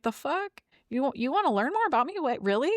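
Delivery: high, incredulous questioning; mock disbelief. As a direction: act pleasantly stunned by unexpected interest. Acts out disbelief at being asked.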